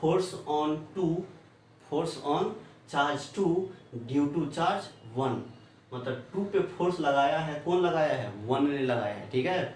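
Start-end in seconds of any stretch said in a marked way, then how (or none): 0.0-5.3 reads the notation word by word, very slowly; slow spelled-out reading. Shown as a demonstration. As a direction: spell out each term slowly for the class. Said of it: "ये क्या है?". (धीरे-धीरे एक-एक शब्द पढ़ते हुए)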